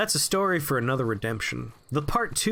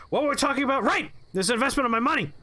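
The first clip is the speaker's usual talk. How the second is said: manic boss; 9 semitones higher, faster than usual. Retold or imitated. Manic boss refocusing mid-rant.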